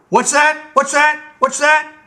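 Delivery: high, loud sputtering repeats; can't believe what he heard; shrieking royal indignation; outraged king. Acted.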